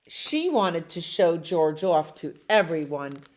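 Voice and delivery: ordinary delivery, nothing marked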